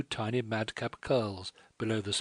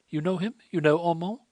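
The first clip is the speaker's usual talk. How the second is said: higher, louder, astonished questions; startled French girl; 8 semitones higher, 7 dB louder than usual. A narrator's reading. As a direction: snap up her head in shock.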